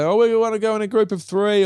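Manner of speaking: high, loud mock whine to the teacher; hammed-up kid haggling over the rules; pleading student. Student asking to go in a group of three, high mock pleading.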